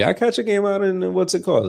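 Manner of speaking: squeaky high goofy voice; high silly voice. Pretend. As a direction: pitch it way up as a joke. Sudden high put-on voice.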